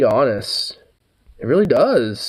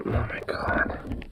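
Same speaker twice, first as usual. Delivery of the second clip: whispering; 12 dB quieter, slower than usual. Real, not acted. Shocked aside after reading.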